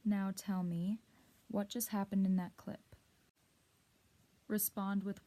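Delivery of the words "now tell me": usual speaking voice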